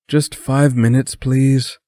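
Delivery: high-pitched sleepy plea; pleading. A narrator's reading. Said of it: "Brett pleaded".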